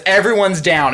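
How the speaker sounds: usual speaking voice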